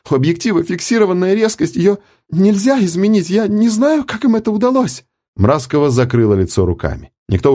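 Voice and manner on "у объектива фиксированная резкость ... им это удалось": distressed. A narrator's reading. Upset operator in tears.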